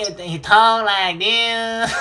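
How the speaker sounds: mock a nasal Southern accent, drawn out; Pattinson's Southern accent. Shown as a demonstration. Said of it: "Southern accent is literally Ernest T Bass".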